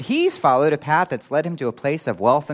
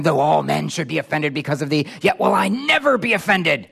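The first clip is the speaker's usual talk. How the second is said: bold vow, climbing high in pitch; Peter; 4 semitones higher, 3 dB louder than usual. Acted. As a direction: declare it with swelling, emphatic conviction. Acts Peter's bold vow to Jesus.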